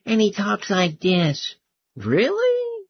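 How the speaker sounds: high, thin falsetto mockery; Blanche's voice. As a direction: mimic him in a squeaky-high voice. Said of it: "but that voice".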